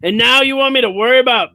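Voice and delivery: high, exasperated complaining voice; whiny stressed man. Retold or imitated. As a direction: whine it like a stressed husband.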